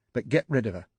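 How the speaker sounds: firm angry command; stern order. Acted. Wife to cheating husband, stern angry order. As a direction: lay down the law coldly.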